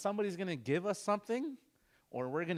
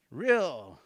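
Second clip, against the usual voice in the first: "real" loud and punched; forceful; 8 dB louder than usual. Shown as a demonstration. Teacher urging a harder sound, "real" said with force. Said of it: "put some force to".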